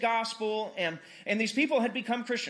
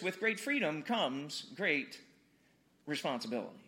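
grave pause before the final word; solemn paraphrase; 6 semitones lower, 5 dB quieter than usual. Acted. Paraphrases the film uncle's line.

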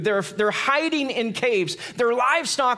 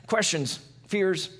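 normal talking voice

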